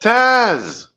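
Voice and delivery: high-pitched exclamation in a fake Aussie accent; triumphant cry. Guest answers the challenge, triumphant high cry. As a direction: belt it out high as the winning answer.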